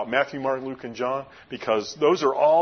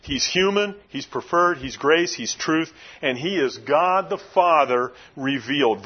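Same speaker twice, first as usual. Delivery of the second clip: normal talking voice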